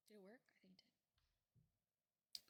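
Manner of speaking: whispered, slow aside. Real, not acted. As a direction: whisper it to yourself. Checking mic quietly.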